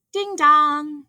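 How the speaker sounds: high sung-out ding dong; voiced doorbell chime; doorbell imitation. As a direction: chime it like a doorbell, holding the second note.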